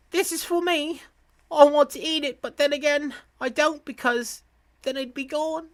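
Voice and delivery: put-on very high little kid voice; child's voice. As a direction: voice a small child torn over a treat.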